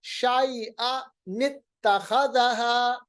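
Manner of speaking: very slow शाइआ नित्तखज़हा with a linking ni; nun qutni reading. Shown as a demonstration. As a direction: recite शाइआ नित्तखज़हा slowly as tajweed. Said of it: "दूसरी जबर को नून जेर नि बनाएंगे".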